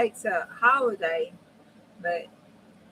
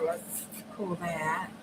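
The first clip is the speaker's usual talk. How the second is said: whisper; 2 semitones lower, 9 dB quieter, much slower than usual. Real, not acted. Crafting, muttering to self.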